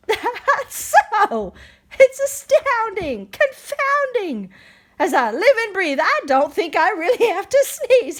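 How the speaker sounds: high, giggly wonder; giggling elephant. Acted. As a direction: giggle through it. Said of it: "he began to giggle".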